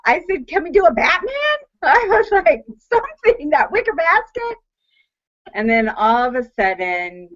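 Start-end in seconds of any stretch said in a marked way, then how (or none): 0.0-4.6 high mocking voice; wife scoffing at the basket, mocking. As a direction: ridicule the idea in a high tone. Retelling scoffing at husband.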